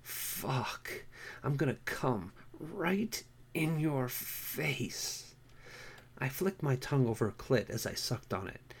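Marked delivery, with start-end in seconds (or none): 0.0-5.3 breathy moan. A narrator's reading. Pam climaxing.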